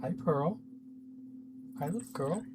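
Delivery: very quiet, gentle hello; soft pet greeting. Real, not acted. Greeting a pet during recording, in a hushed voice. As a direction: murmur it softly to a pet.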